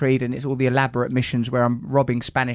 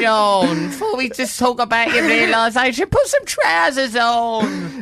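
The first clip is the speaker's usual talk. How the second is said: high, loud comic mother voice; hammed-up exasperated mum; scolding mum; 9 semitones higher, 4 dB louder, slower than usual. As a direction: nag him in a stretched-out high voice. Acts mum scolding son on doorstep.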